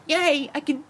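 high, loud woman impression; mock cheering. Voicing the film's woman for a friend, high mock cheer. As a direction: squeal it as a hammed-up cheer.

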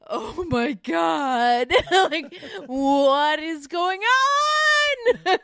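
high, slow wail building to a long scream; hammed-up horrified fan, stretching every word; shocked wailing. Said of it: "we were all like".

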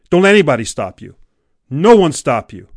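loud motivational push; rousing exhortation. Real, not acted. Coach urging listener past all obstacles, rousing. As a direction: drive it home, punching the first words.

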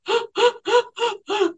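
sharp high gasp for breath; imitated gasp. Medium retelling a grieving client's sob, high gasp. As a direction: mimic a client's sobbing gasp. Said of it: "gasping, sobbing".

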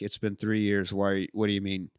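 low, soft mock deadpan reply; lightly voiced unimpressed husband; weary husband. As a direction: quietly drop the energy, a touch weary. Weary husband answering chipper wife.

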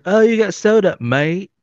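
high put-on British voice; British accent. Correcting friends by imitating the plane passenger, high British accent. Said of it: "I heard a British accent".

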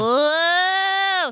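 long falling high "Whoa!" like a game bug; cartoon whoa sound. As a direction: imitate a toy creature's cry. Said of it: "Who makes that sound?".

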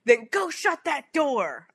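slow, stern scolding; stern man voice. Retold or imitated. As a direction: firmly order the door shut. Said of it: "He was like".

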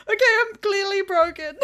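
high, weepy surrender; mock tearful admission. Worker conceding to a colleague, high weepy admission. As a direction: give up weepily. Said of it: "It's like".

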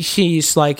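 ordinary talk, nothing marked

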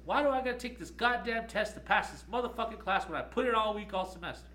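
high, loud indignant rant; mock-rant at the unfairness of the test; imitating a fed-up student's outburst; angry complaint. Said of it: "she goes".

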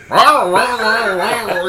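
loud, high wordless impersonation; Jeff Bridges voice. Acted. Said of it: "doing his Jeff Bridges voice".

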